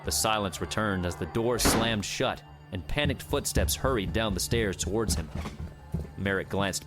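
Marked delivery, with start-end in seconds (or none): none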